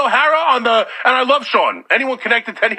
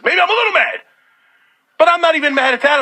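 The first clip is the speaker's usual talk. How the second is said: higher, quicker, dismissive bounce back; brushing it off; 5 semitones higher, faster than usual. Real, not acted. Host shrugging off his own anger to listeners. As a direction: wave the thought away.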